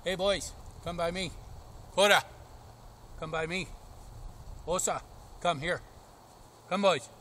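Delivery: loud, high call to the dogs across the field. Real, not acted. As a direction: call the dogs back from far off. Calling dogs from afar.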